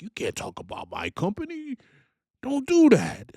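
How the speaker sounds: mocking Prime drivers defending their company; defensive loyal driver. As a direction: whine it like a hurt fan waving pom-poms. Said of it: "drivers defending with their pom-poms".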